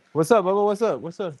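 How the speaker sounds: gentle high parent voice. Real, not acted. Parent checks on crying child.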